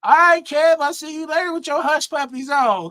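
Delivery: mock-condescending, high and loud. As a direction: imitate a woman talking down to him. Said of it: "she said it in such a condescending way".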